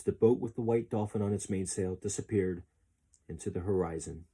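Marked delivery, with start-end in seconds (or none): none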